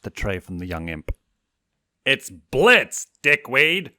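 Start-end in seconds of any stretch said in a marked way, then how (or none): 2.1-3.9 loud, annoyed correction; scowling shout. A narrator's reading. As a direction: snap back at the clerk, loud. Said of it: "he scowled".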